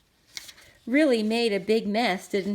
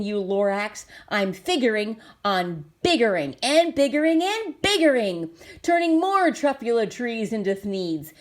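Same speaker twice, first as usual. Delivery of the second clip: louder defiant tirade; yelling Onceler; 6 dB louder than usual. Acted. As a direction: shout him down, stubborn and proud. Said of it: "I yelled at the Lorax".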